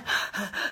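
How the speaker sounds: quick breathy pants, no voice; demonstrative huffing and puffing; exaggerated panting. Shown as a demonstration. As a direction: pant like you're out of breath. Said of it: "out of breath here. I'm like".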